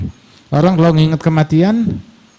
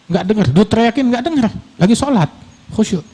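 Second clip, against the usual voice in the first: ordinary talk, nothing marked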